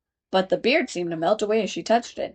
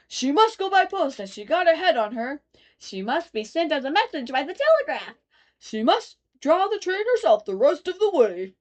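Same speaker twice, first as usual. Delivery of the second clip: higher chorus of odd voices; chorus of passengers; 7 semitones higher than usual. A narrator's reading. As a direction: chant suggestions as a crowd. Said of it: "the other voices went on".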